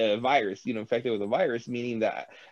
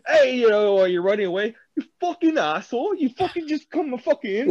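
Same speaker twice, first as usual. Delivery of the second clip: mock British accent, high and loud, ranting; British zombie; 9 semitones higher, 5 dB louder than usual. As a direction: yell like an outraged Brit chasing someone. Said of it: "the zombies are British, so they'll fucking be like".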